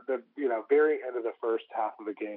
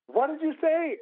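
very high, mock-incredulous kid voice; louder-pitched playful cry of disbelief; astonished kids; 4 dB louder, slower than usual. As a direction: imitate kids floored by what they heard. Kids astonished at his ranking.

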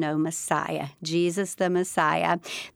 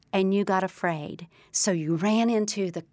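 ordinary talk, nothing marked

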